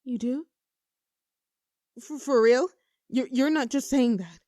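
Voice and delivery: can't believe what they just heard; stunned disbelief. Acted. To the crush who said yes, loud stunned disbelief.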